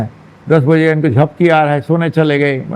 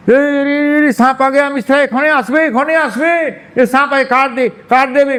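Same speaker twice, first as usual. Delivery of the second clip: high-pitched frantic calling in Bengali; panicked cry in Bengali; panicked Bengali mother; 8 semitones higher than usual. Acted. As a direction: cry out in alarm like a Bengali mother seeing a snake. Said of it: "Bengali थोड़ा थोड़ा आता है।".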